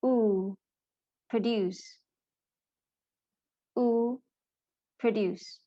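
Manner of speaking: drawn "Ooh", then "Produce" with that vowel stressed; long oo in produce. Shown as a demonstration. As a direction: model "Produce" stressed on the second syllable with long oo. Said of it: "how these words are supposed to be pronounced".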